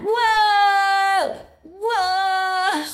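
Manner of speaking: loud, amazed whoa. Real, not acted. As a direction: shout it in surprise. Amazed at hat.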